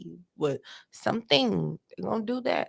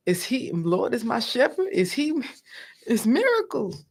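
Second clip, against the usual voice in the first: mock confused prayer; 5 semitones higher than usual. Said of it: "I'm like".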